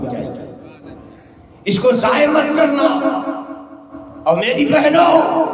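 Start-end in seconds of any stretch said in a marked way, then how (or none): 1.6-5.4 high, louder preacher call; preacher appealing to the crowd; fervent exhortation. Real, not acted. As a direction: urge the congregation.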